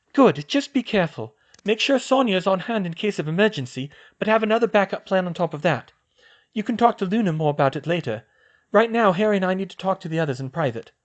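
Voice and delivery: put-on girl's voice. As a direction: voice the girl, a clear step up in pitch. Female character voiced high.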